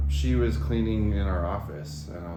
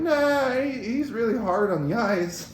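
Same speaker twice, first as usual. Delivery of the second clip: high mock voice; 13 semitones higher than usual. Retelling answer about brother.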